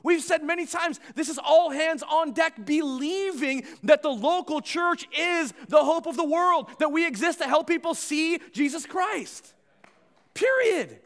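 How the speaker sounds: loud, high, full-force sermon delivery; preacher at full pitch; impassioned preaching. Real, not acted. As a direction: drive it home at full volume to the whole room.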